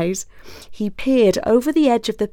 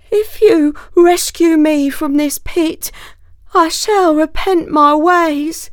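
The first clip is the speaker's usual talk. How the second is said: higher, louder howling promise; howling plea; 9 semitones higher, 5 dB louder than usual. Acted. Wolf howling promises to the fox, desperate. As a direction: wail it up from the pit. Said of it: "howled the wolf".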